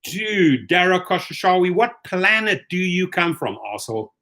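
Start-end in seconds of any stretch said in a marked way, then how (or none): none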